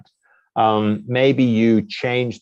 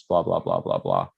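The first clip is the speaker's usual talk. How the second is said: much lower, trailing blah blah; dismissive blah blah. Shown as a demonstration. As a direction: mumble it off as filler, dropped low. Skipping rest of bio text.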